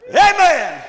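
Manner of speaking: high, loud preacher's amen; shouted amen. Acted. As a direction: call it out to the crowd.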